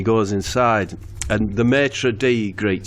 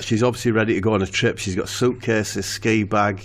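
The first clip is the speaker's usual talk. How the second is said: ordinary talk, nothing marked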